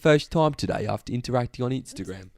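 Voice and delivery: put-on British accent, demonstrating. Shown as a demonstration. Co-host prompting the reader to relax, British accent. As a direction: show how the British accent goes. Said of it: "give you a bit of prompting".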